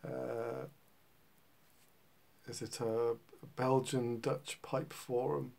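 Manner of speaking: usual speaking voice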